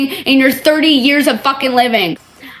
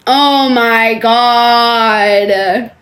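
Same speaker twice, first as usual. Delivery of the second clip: drawn-out exasperation; 2 semitones lower, much slower than usual. Real, not acted. Exasperated at her reply.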